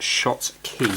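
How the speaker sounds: "Shot Key" said as two slow, separate words; "Shot Key" with a sh at the start and key at the end; correct pronunciation. Shown as a demonstration. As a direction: say "Shot Key" slowly, SHOT then KEY. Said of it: "It should be pronounced".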